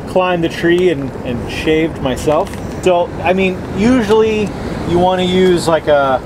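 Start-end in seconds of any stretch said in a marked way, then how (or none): none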